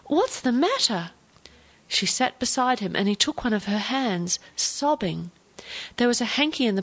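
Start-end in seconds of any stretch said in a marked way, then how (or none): 0.1-1.1 raised pitch, a touch of alarm; mildly distressed, concerned question. A narrator's reading. Granddaughter finds Grandpa in tears, mild alarmed cry. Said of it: "she cried".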